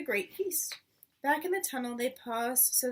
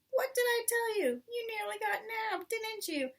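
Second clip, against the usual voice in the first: high, shrieky rat voice; a rat shrieking at the foxes; 9 semitones higher than usual. Acted. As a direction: scold like a shrill nasty rat.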